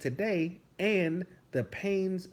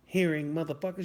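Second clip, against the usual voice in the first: ordinary talk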